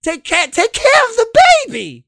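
high falsetto housewife; man doing a woman's voice. Retold or imitated. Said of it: "talking like the lady".

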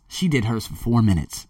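ordinary talk, nothing marked

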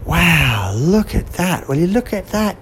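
put-on awed girl staring out to sea; amazed. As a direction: gasp at the strange ship. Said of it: "the girl and the cat were saying".

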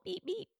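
high, soft little horn beeps; a weak, sad toy horn; pitiful horn beep. Pretend. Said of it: "the one that sounds pitiful".

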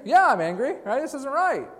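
put-on high, loud childish yell of protest; whiny angry shout. Jonah shouting back at God, whiny and angry.

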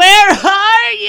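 high slow wailing shout; loud wailing call. Real, not acted. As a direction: wail it into the phone. Yelling into voicemail.

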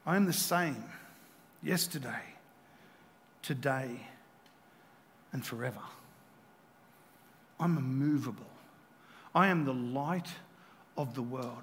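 low, soft, with long pauses between phrases; hushed solemn declaration. Acted. Preacher speaking as Jesus, the unmoving light, hushed. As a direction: let each phrase settle quietly into silence.

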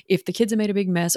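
ordinary talk, nothing marked

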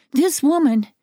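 put-on high, urgent exclamation from a boy who ran in; panting child. A narrator's reading. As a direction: blurt it high, as if just run in.

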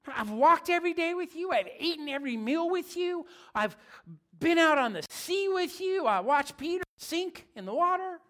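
high, loud shocked plea; a disciple who can't believe Jesus would leave; upset follower begging Jesus not to go; disbelieving disciple. Acted. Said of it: "They gotta be freaking out".

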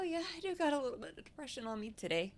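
high, fast put-on whiny voice; mock gloomy voice. Co-host jokes about faking through depression, high fast put-on voice. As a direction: play a fake cheerful sufferer, high and quick. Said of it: "I'm like".

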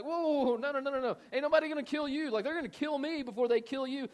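high, loud, rapid-fire objection; mad protest. Retold or imitated. Peter refusing to let Jesus be killed, mock-mad protest. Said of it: "Peter's mad now. He's like".

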